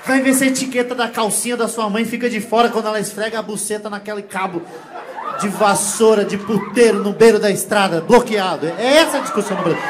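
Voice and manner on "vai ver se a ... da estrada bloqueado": louder rant building to a shout; mock furious rant. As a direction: unload the insult, laughing. Tirada encenada, crescendo. (xingamento furioso de brincadeira)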